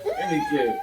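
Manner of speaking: squeaky playful high voice; silly high voice. Pretend. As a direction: coax in a goofy squeak. Dad playfully coaxing his son.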